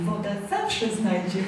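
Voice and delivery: soft, unhurried mock-wise remark; Norbert. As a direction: drop to a small, serene voice after the commotion. Mimics calm Norbert after the panic.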